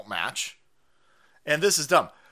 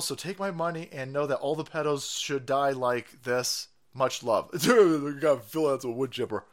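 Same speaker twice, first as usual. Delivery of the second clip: normal talking voice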